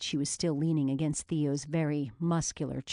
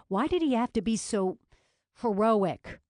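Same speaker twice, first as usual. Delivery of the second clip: exasperated; 6 semitones higher, 4 dB louder than usual. A narrator's reading. Exasperated inner thought.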